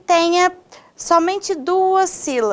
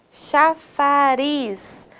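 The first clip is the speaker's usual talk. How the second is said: CHAFARIZ drawn out slowly, long RIZ; stressed final syllable; much slower than usual. Shown as a demonstration. Teacher quizzing students on stress, CHAFARIZ very slowly with long RIZ. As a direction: stretch CHAFARIZ syllable by syllable towards a held RIZ. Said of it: "Qual é a sílaba mais forte".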